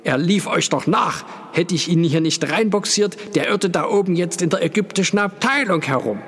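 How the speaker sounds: high, hurried agitated outburst. A narrator's reading. Porter complaining to the group about the runaway, agitated. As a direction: pour it out, worked up and high-pitched. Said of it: "sagte der Pförtner erregt".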